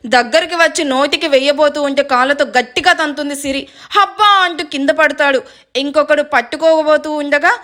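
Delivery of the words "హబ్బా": high yelp of pain; pain cry. Acted. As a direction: cry out as the kick lands. Kicked thug falls in pain.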